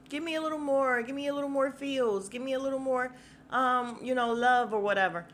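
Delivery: sneering impression of a clingy contestant; mocking needy whine. Mocking her begging for attention.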